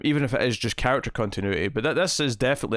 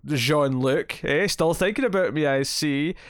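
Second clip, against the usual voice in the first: higher, brash, flirty mock Lwaxana; pushy Lwaxana impression; 4 semitones higher, slower than usual. Podcast host imitates Troy's mother barging onto screen, high and brassy. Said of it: "Troy's mother ... goes".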